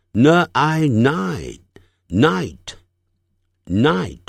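very slow 呢, I, joined into night; sound-by-sound blending. Shown as a demonstration. Teacher building night from its sounds, slow and separated. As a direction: spell out 呢 plus I, then blend to night with pauses. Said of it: "你就把它当作呢".

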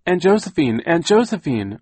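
high, loud searching call, falling at the end. A narrator's reading. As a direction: call out for a missing aunt. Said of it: "Violet called".

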